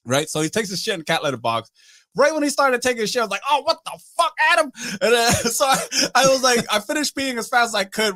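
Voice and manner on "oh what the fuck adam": high-pitched, disgusted protest, retold; appalled outcry. Storyteller reacting to friend using litter box, appalled outcry. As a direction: act out being grossed out by a friend.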